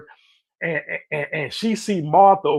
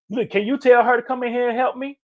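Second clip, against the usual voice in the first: higher, bossy plea; frustrated demand; 8 semitones higher, 3 dB louder, faster than usual. Retold or imitated. Martha instructing Jesus to make Mary help, exasperated. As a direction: tell him what to do, exasperated. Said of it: "You see the frustration?".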